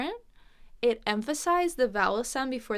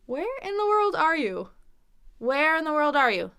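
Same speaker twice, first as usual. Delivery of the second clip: exasperated; 5 semitones higher, 6 dB louder than usual. Acted. Exasperated example question, acted.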